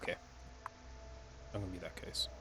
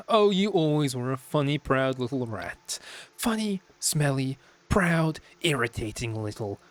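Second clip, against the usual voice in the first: high, louder sneering sister; belittling sister; 4 semitones higher, 5 dB louder, slower than usual. Acted. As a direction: pile on the insults slowly.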